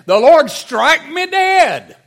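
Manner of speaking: slow, high, booming shout; full-voiced, drawn-out oath cried out; vehement curse. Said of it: "this is even stronger than taking an oath".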